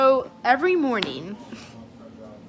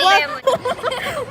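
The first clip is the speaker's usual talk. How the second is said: ordinary talk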